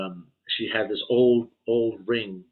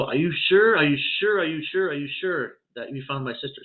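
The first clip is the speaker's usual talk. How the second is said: higher, urgent repeated questions fading down; stunned disbelief; 5 semitones higher than usual. Retold or imitated. Brother hearing his lost sister was found, stunned. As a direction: ask again and again, not believing it.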